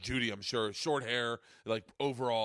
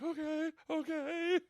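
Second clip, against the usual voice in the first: slow and much higher, a mock-frightened "okay, okay"; strained okay; slower than usual. Holding the operator's hand, slow high frightened okay. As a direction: squeeze it out slow and high like a scared kid. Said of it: "I was like".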